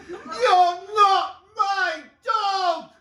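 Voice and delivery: very high, very slow, loud; shrieking woman. Acted. Woman at her wits' end with the dog, stretched shriek. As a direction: stretch every word into a shriek. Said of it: "At different levels".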